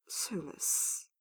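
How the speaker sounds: drowsy, half-voiced utterance; murmured. A narrator's reading. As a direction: murmur the name under your breath. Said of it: "he murmured".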